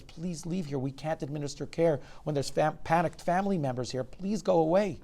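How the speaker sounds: urgently sending a relative away; urgent plea. A narrator's reading. Doctor pleads with panicked relative.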